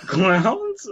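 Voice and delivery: high, rising disbelief on clowns; laughing disbelief; incredulous echo. Real, not acted. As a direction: repeat it like you can't believe it.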